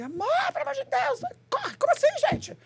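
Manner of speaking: high, urgent mock yell at husband; retold panicked yell. Wife yelling at husband to hurry for stage, high panicked yell. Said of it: "Não, eu gritei".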